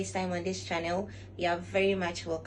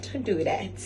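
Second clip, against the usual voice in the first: usual speaking voice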